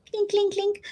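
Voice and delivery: high, soft clink sounds, a mock tapping; playful clink clink clink; clinking noise. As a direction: tap it out lightly, pitched high. Imitating eyelash sound.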